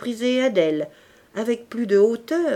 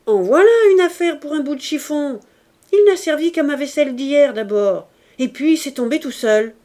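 loud, higher peacemaking call; 5 semitones higher, 8 dB louder than usual. A narrator's reading. Adèle calling from her kitchen window. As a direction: call it across the courtyard. Servants' quarrel shouted across courtyard.

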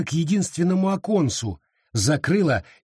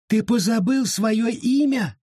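high taunting spirit; 9 semitones higher than usual. Acted.

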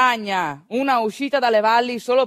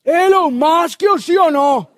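higher, louder, slow blunt demand; demanding fathers; 6 semitones higher, 8 dB louder, slower than usual. Retold or imitated. Rough fathers in the courtyard demand news from Gilda, loud. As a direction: boorish men barking for news.

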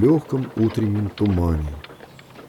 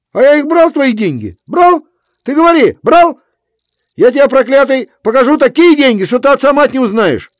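very high, loud furious shriek; shrieking fury; 13 semitones higher, 7 dB louder than usual. A narrator's reading. Kuzma denies theft to Efrem, shrieking fury. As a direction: scream your denial.

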